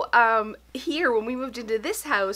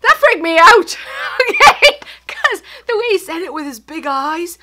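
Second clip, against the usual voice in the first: louder and higher, laughing through the words; nervous laughing; 7 semitones higher, 13 dB louder than usual. Real, not acted. Mother recalling the scare, breaking into nervous laughter. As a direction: laugh off the fright as you tell it. Laughing at her own fright.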